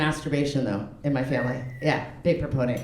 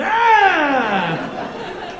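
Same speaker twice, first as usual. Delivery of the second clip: high, loud frustrated T-Rex yell; comic frustrated T-Rex scream; grumpy T-Rex cry; 9 semitones higher, 4 dB louder than usual. Acted. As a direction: yell "ah" like a short-armed, grumpy dinosaur. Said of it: "T-Rex, short arms, so grumpy".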